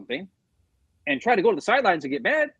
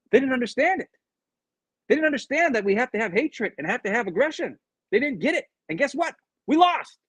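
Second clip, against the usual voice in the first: high-pitched frustrated player, imitated; exasperated retelling; 8 semitones higher than usual. Player on teammates who lacked aggression, exasperated.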